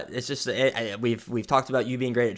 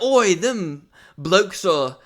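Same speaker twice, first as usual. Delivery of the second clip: high, slow British bloke parody; hammed-up British "Oi, them blokes"; mock British accent; 8 semitones higher, 3 dB louder, slower than usual. Friend imitating British headline, hammed-up British accent. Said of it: "being stolen in Britain".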